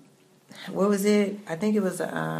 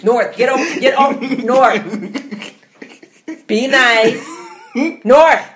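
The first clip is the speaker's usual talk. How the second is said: loud, high mock scolding; scolding mom voice; 7 semitones higher, 9 dB louder than usual. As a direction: shoo the child off. Voicing mother scolding child.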